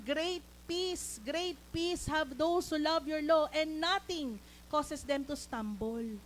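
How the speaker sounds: slow, emphatic verse reading; declaimed scripture. Real, not acted. Preacher quoting the verse to congregation, slow declaiming. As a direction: proclaim it slowly, stretching the key words.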